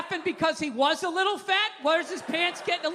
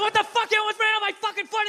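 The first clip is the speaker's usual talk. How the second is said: high, fast irate outburst; imitated angry screaming; 7 semitones higher, 3 dB louder, faster than usual. Pedestrian yelling over the driver's apology, mock furious. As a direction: mimic an outraged pedestrian cutting in. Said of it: "He's screaming at me".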